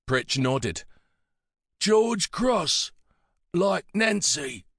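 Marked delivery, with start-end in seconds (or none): 1.8-4.7 put-on high, slow old man's voice; halting phrases from an elder; frail old man. A narrator's reading. Pritch linking Nancy to the medal, high frail voice.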